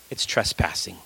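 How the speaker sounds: hushed emphasis. Acted. Preacher drops voice on key word.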